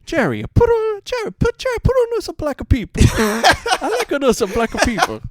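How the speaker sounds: put-on very high, loud old man's voice; an old Italian grandpa asking Jerry for a movie; old Italian grandfather.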